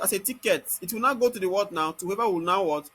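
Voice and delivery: ordinary talk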